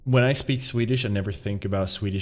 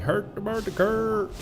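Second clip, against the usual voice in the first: much higher mock-Swedish Muppet babble; Swedish Chef; 10 semitones higher than usual. Streamer showing how he just goes, high mock-Swedish babble. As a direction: put on a high sing-song cook's gibberish. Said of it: "That's like the Swedish chef".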